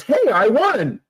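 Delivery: high-pitched cocky crow; smartass. Retold or imitated. Joking at the prize draw, smartass. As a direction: pipe up like a smartass. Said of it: "me being a smartass says".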